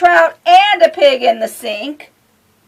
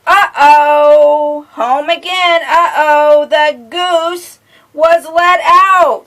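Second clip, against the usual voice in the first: sing-song picture-book reading; reading to children; 4 semitones higher, 8 dB louder, much slower than usual. A narrator's reading. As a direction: lilt it for a little listener.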